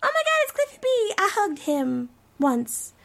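high, squealing starstruck voice; fangirl excitement. Retold or imitated. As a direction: gush like a starstruck fan. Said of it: "'cause I'm like".

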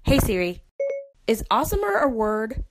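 ordinary delivery

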